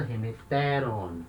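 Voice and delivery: slow, falling "remeteron", leaning on TE; accent shifted one syllable. Shown as a demonstration. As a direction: stress "remeteron" on TE, drawn out. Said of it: "acento ando uma casa".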